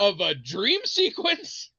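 high, slow, drawn-out point; emphatic retold argument. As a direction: spell it out in mock exasperation.

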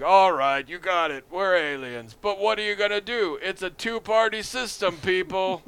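alien candidates. Retold or imitated. Exposed aliens addressing voters, loud mock defiance. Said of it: "And they're like".

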